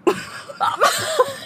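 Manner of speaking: squealing burst of laughter; high shrieking laugh. Real, not acted. Laughing at friend's voice.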